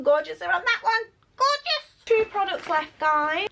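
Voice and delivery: high, loud excited squeal; giddy high-pitched call to viewers. Real, not acted. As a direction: squeal it with delight. Squealing over the last products.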